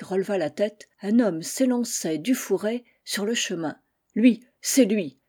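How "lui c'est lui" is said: slow, louder narrated exclamation; crying out. A narrator's reading. King recognising his rival on the path, crying out. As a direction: call it out in startled recognition, measured pace. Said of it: "s'écria le roi en reconnaissant son rival".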